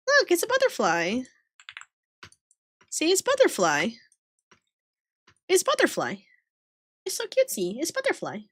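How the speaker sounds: high, small cutesy voice; cutesy insistence. Pretend. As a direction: coo it high and light. Insisting cutely it is a butterfly.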